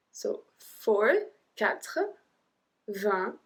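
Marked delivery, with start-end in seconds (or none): none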